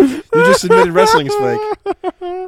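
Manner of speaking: high, loud and slow gloating; gleeful gotcha. Real, not acted. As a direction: drag it out, crowing. Gleeful repeat of accusation.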